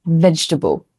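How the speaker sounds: vegetable in three syllables, VEJ-tuh-bul; a clear vegetable for students to repeat; model pronunciation. Shown as a demonstration. Teacher models vegetable for the class to repeat. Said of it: "say it again with me".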